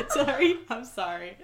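giggling through an apology; apologizing while laughing. Real, not acted.

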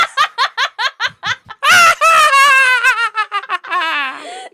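high, loud, shrieking cackle; a long evil cackle, near screaming; evil chuckle. Real, not acted. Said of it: "sinister laugh".

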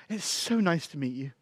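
soft, polite farewell; gentle. Retold or imitated. Voices Heidi's soft, polite goodbye.

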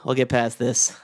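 halting, resigned farewell, sighing; low, resigned mock condolence; mock grief. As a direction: deliver a hammed-up eulogy with long pauses. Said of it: "all the stages of grief".

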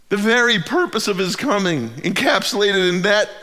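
loud, high, impassioned preaching; fervent pulpit climax; preaching at full force. Real, not acted. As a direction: lift the room toward hope. Building sermon climb at full force.

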